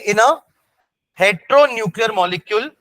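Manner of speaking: slow, measured dictation for note-takers; teacher dictating notes at half speed. Real, not acted. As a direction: say it slowly, phrase by phrase, with pauses.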